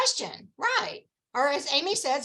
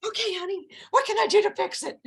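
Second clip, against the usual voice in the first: lightly voiced husband, pitched higher; placating husband; 6 semitones higher than usual. Husband relieved it's not him, mildly mock-placating. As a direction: imitate an eager-to-help husband.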